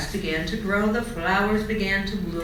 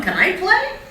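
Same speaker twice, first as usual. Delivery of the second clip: high, louder hopeful ask; eager caterpillar begging to play; hopeful plea; 7 dB louder than usual. A narrator's reading. Charlie pleads to join.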